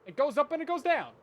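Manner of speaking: high, loud blurt; exasperated loud outburst. Real, not acted. Streamer groping for a forgotten mapping word, loudly exasperated. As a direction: raise pitch and volume while hunting for the word.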